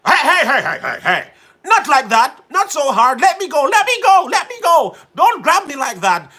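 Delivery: loud, high mock panic; acted protest. Host acting out being grabbed too hard, hammed-up shouting. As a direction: cry out to be released, hammed up. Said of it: "let me act a two-part thing".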